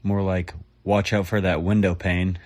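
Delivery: ordinary talk, nothing marked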